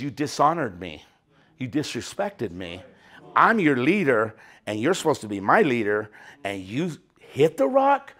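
low, soft, rebuking God; stern God voice. Retold or imitated. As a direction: speak as God, low and quietly reproachful. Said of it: "he goes".

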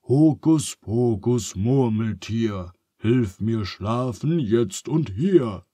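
slow chanted magic spell; incantation. A narrator's reading. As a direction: intone the spell slowly and grandly. (langsam beschworener Zauberspruch)

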